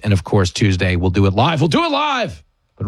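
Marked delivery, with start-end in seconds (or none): 1.6-2.4 high-pitched exclamation, falling at the end; hammed-up cry, pitched way up; mock outburst. Host promising Tuesday's live show, mock outburst.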